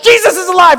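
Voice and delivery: high, loud acted proclamation; proclaiming shout. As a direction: shout it out as joyful news. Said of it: "suddenly hears it in his language".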